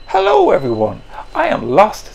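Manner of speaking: put-on high, small young whale voice calling out; little lost whale. Finn greeting the sea creatures, a high little voice. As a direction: pipe it up like a lost baby whale.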